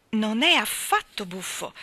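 slow, emphatic reproof; stern. A narrator's reading. Mary Poppins correcting the children, stern reproof. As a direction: put them firmly in their place. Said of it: "li guardò con grande severità".

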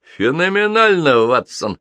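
ordinary delivery, nothing marked

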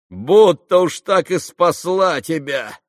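high, loud, slow sneer; a touch of spiteful laughter in the sneer. A narrator's reading. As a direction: scoff at him with malice. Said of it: "засмеялся Ракитин злобно".

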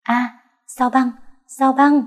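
eager cry, pitch climbing on each sao băng; excited cry. A narrator's reading. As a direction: voice leaps up, bright and rising. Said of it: "tôi vội hét lên".

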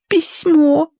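high, strained single word; squeezed out. A narrator's reading. Maid explains to the colonel, forcing it out high. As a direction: force the word out reluctantly. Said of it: "выдавила она".

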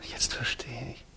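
breathy whisper, slow, almost no voice; stunned whisper. Acted. Haldin whispers to Rasumov, stunned by his confession. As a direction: let the realization drain the voice out of you.

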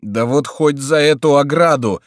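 urgent cry. A narrator's reading. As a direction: call out while climbing. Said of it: "вскричал Митон".

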